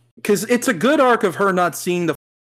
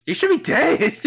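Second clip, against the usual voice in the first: mock dramatic line; 12 semitones higher than usual. Mocking a movie line.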